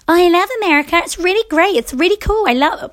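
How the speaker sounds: high, breezy gushing; mock gushing privileged girl. Podcaster mocking her own privileged take, high and gushing. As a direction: gush about America without a care. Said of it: "it's very easy for me to say".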